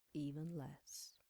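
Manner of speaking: slow whispered aside. Acted. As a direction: breathe it out quietly. Hushed aside.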